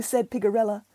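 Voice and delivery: ordinary delivery, nothing marked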